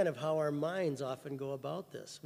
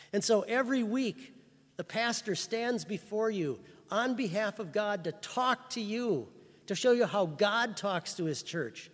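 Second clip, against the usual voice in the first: louder, higher, fervent sermon voice; full-force preaching; 4 semitones higher, 5 dB louder than usual. Acted. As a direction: lift the congregation with it.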